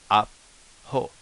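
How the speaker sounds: "Apo" cut by a held glottal stop after the A. Shown as a demonstration. Teacher showing learners the glottal stop, "Apo" broken by a silent catch. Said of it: "had the little glottal stops".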